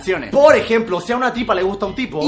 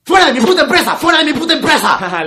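higher, louder angry shout; furious boss yell; 6 semitones higher, 7 dB louder than usual. Acted. Boss to González, yelling him out of the company. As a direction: throw him out at full volume.

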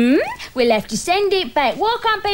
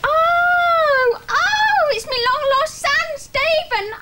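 very high, shrieking cry of joy; hammed-up soap-opera reunion; overjoyed mother; much slower than usual. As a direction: squeal with delight at the son's return. Mother greets long-lost son.

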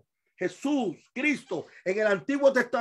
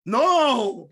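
ordinary delivery

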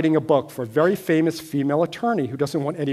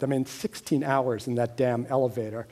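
ordinary talk

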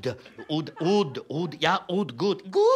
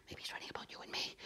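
fast worried whisper; 20 dB quieter, faster than usual. Acted. Actors fear the critic.

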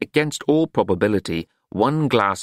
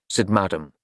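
plain reading voice

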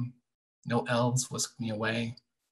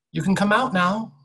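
higher, louder coaxing call; 9 semitones higher, 5 dB louder than usual. A narrator's reading. Girl calling the hidden boy out, coaxing. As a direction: call gently into the empty woods.

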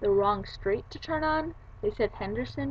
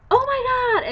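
very high, loud mock squeal; imitated excited squeal; 9 dB louder, slower than usual. Author recognizing the student who wrote to her, excited squeal. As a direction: exclaim it like a thrilled author. Author's thrilled reaction, acted out.

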